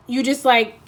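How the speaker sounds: normal talking voice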